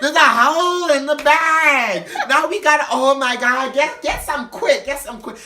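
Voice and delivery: high, loud, slow shrieking panic; hammed-up panicky drunk; panicked drunk. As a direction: freak out over the spill. Imitating panicked drunk friend.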